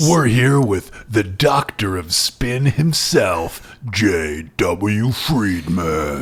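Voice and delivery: low slow TV announcer intro. Pretend. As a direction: introduce the guest like a talk show host. Announcing guest like TV host.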